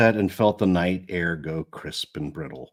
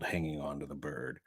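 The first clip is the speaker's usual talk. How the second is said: plain reading voice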